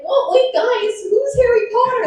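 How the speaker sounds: very high innocent put-on voice; playing dumb. To her friend, playing dumb in a very high voice. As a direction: feign total cluelessness, way up high. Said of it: "I was like".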